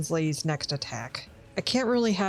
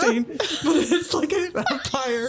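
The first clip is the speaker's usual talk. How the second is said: high giggling speech; 10 semitones higher than usual. Real, not acted. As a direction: giggle through the words. Giggling fit.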